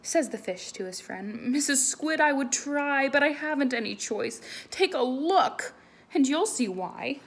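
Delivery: lively, loud read-aloud voice; animated picture-book reading; story reading to children. Acted. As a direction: read it big for the kids.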